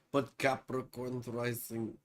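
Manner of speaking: low, soft mock-Russian voice; Russian accent. Back to Pamela's rising sign, playful Russian accent. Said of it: "Russian accent with Capricorn rising".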